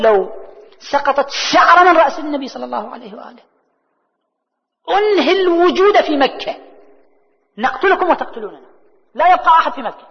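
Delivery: loud, high, forceful warning; emphatic high-pitched declamation of a threat; retold stern threat. Retells Abu Talib's threat to Quraysh.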